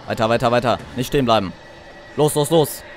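loud panicked urging; being chased. Real, not acted. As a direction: run and push on in fear. Fleeing the monster.

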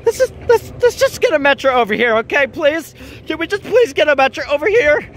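high, agitated begging; frantic pleading. Real, not acted. Vlogger begging for a Metro to come over, frantic pleading. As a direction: beg desperately, pitched high and loud in bursts.

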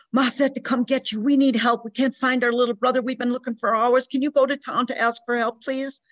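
put-on high, breathless plea from a scared twelve-year-old; girl pleading for help after running, frantic and high. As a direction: beg for help, out of breath and frightened. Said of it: "Her face frantic".

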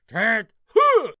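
loud, high barked Tent Hut; sergeant's drill call. Retold or imitated. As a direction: bellow Tent Hut across the hut. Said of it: "McGovern let out with a".

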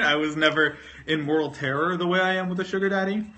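ordinary talk

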